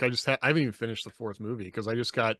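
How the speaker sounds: normal talking voice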